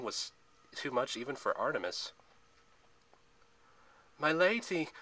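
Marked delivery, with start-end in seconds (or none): 4.2-4.9 high, loud cry to her mistress; shouted call. A narrator's reading. Zoe rushes to her captive mistress, shouting. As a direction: call out urgently, pitched up. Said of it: "Zoe shouted".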